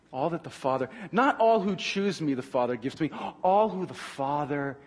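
ordinary delivery

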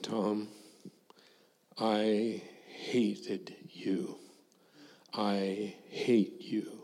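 slow unvoiced whisper of old resentment; hushed confession. Former student confessing to the missionary in a slow whisper. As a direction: breathe the words out slowly, barely voiced.

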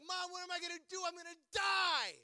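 high, hammed-up wailing to mom; comic high-pitched wail; mock despair. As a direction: act out a panicked child pleading. Retells crying to mom.

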